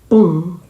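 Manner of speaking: loud sharp mouth-made bang; gunshot imitation. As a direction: pop out the shot. (estampido imitado de tiro)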